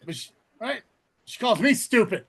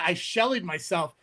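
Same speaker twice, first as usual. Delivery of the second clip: normal talking voice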